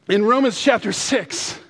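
sudden emphatic burst; emphatic self-correction. Real, not acted. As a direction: call out the corrected reference. Corrects himself after naming John.

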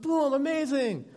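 high-pitched wowed exclamation; excited kid. Retold or imitated. Boy showing parents the wrestling finish, excited. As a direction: boom it out like an amazed child. Child demonstrating the wrestling move.

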